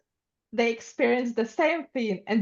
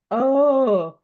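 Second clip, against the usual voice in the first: loud, raised, drawn-out oh; exaggerated mock reaction; 4 semitones higher, 9 dB louder than usual. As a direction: imitate an overdone, gushing reaction. Mimics others reacting to Ukrainians.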